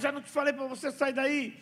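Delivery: put-on scolding a kid. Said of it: "E eu falei, rapaz".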